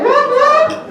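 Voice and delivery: loud, high celebratory whoop; big high cheer; excited whoop. Real, not acted. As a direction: whoop it up for the crowd. Cheering the audience's vote.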